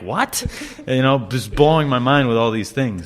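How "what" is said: high disbelieving what, rising sharply; a mock-astonished yelp of disbelief; stunned what. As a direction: squeak it out like your mind is blown. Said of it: "And I'm like".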